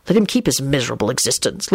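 low, idle remark. A narrator's reading. Lupin lets Scully keep his existence, idly. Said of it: "Lupin said idly".

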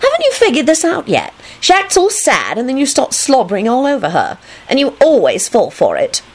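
high-pitched know-it-all voice; high, put-on smug scolding. A narrator's reading. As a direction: lecture him knowingly. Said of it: "she announced knowingly".

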